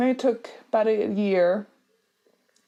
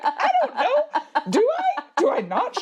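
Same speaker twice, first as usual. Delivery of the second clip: flustered panic; 11 semitones higher than usual. Retold or imitated. Asking friend whether to tell husband, high laughing panic. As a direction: wail it high through laughter, unsure.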